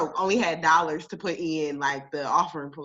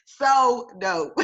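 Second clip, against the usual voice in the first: bursts of high laughter around the words; laughing; 10 semitones higher, 7 dB louder than usual. Real, not acted. Vlogger laughing at how painless tithing feels, high giggling laughter. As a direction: laugh it out, delighted. Laughing at her own tithe joy.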